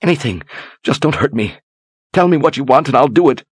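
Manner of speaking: high, pleading voice; terrified begging. Acted. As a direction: beg him not to hurt her.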